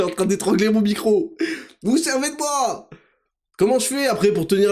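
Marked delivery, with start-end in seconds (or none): 1.8-2.9 mock indignation.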